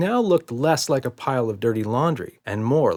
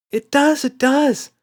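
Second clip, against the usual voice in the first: high, loud insisting; insistent child; 8 dB louder, slower than usual. A narrator's reading. Emma insists to Daddy, high and loud. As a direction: squeal it like a stubborn kid.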